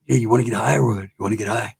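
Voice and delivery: low homeboy voice, tailing off softly; mock shady homeboy invitation; homeboy offer. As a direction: slip the offer in, low and furtive. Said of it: "that's what they do".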